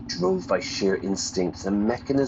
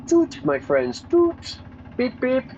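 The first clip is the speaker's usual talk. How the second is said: high tooting horn noise; car horn toot; slower than usual. Acted. Car horn cheer while driving.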